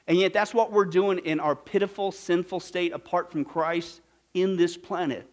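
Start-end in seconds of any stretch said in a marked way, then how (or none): none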